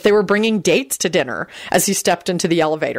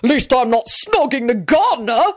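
high, blustering comeback; high-pitched indignant retort; bellowed retort; 6 semitones higher than usual. A narrator's reading. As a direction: fire it back in a mild bellow. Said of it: "Richard bellowed back".

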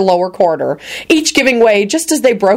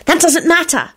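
high, tense scolding; angry whisper; 10 semitones higher than usual. A narrator's reading. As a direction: scold urgently in a thin high voice. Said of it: "Esther whispered angrily".

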